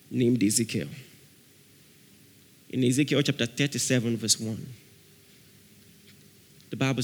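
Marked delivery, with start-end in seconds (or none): none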